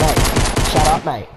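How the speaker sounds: loud, irritated telling-off; annoyed retort. Pretend. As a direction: raise your voice to shut a teammate up. Mock squabble, snapping back at teammate.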